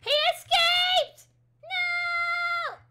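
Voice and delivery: high, loud, drawn-out cry of alarm; panicked shriek. Real, not acted. Gamer shrieking that her llama ran off, panicked. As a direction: shriek it like your pet just bolted.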